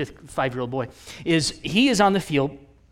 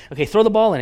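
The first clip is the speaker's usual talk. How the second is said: louder, higher sideline instruction; coach calling out; 5 semitones higher, 8 dB louder, faster than usual. Retold or imitated. Coach to child at the sideline, calling out. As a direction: call the instruction across to a kid.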